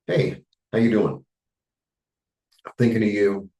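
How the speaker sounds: lightly acted caring call; caring phone greeting. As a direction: greet a worried friend kindly on the phone. Calling friend in California.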